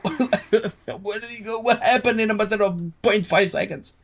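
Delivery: laugh, then ask in high-pitched disbelief; cracking up, totally lost; amused bewilderment. Real, not acted. Laughing at sudden scene change.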